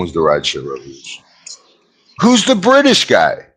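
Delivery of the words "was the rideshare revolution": hushed, unsure aside; whisper. Real, not acted. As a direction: whisper it to yourself. Unvoiced aside while unsure.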